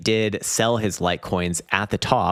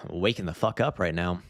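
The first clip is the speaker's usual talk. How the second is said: usual speaking voice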